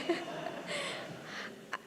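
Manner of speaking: a real burst of laughter, high and airy. Real, not acted. Remembering the mirror gift, she breaks into a high breathless laugh.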